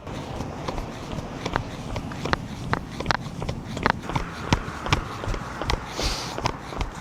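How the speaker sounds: long breathy blow-out exhale; slow controlled breath out. Real, not acted. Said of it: "running out of breath".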